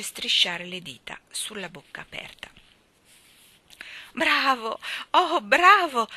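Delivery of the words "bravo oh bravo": louder delighted mother; a mother thrilled with her child; admiring praise. A narrator's reading. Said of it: "disse la madre ammirata".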